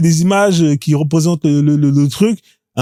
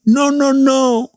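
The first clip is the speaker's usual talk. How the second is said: high, long-drawn mock no; slow, high-pitched imitation of protest; mimicked refusal; 7 semitones higher, much slower than usual. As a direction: stretch each no in a high voice. Mimics the president's refusal from clip.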